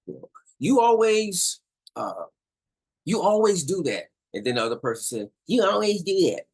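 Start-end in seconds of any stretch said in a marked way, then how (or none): 0.6-4.1 higher and louder finger-pointing complaint; accusing. Acted. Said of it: "or mocking. All right. For example". 5.5-6.5 high, loud mimicking retort; mock the other person's complaint; mocking echo. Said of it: "or mocking. All right. For example".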